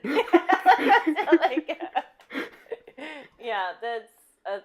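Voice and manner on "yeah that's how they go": high, loud laugh through the words; giggling agreement. Real, not acted. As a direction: laugh it out, slowed. Laughing reply.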